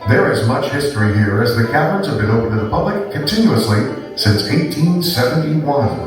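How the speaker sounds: announcer read. Acted. Recorded cave welcome announcement.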